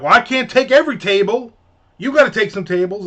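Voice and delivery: loud, high-pitched retold complaint; mock arguing. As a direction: argue like a fed-up waitress. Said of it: "And they're arguing".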